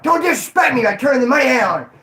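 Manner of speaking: hammed-up threatening shout; aggressive. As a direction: imitate a menacing guy insisting. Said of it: "All aggressively".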